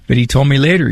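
ordinary delivery

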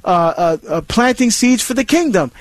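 ordinary talk, nothing marked

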